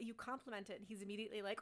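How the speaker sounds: normal talking voice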